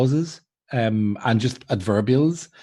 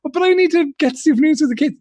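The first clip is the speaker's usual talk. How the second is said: pleading. Retold or imitated. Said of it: "and it's like".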